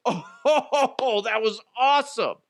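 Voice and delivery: high, giggling gleeful cheer; laughing delight. Retold or imitated. Cheering the cannonball scene in the theater, high laughing delight. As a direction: laugh out the thrill. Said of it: "and I'm like".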